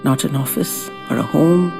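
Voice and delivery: ordinary delivery, nothing marked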